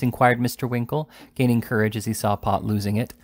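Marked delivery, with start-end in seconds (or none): none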